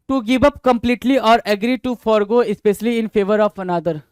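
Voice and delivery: reading the definition out slowly; slow dictation. Shown as a demonstration. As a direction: read it slowly so students can note it down. (धीमी आवाज़ में परिभाषा पढ़ना)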